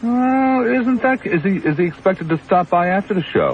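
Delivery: squeaky comic persona needling a guest; falsetto comic character. Acted. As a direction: tease the caller in a silly high voice. Host's put-on character voice.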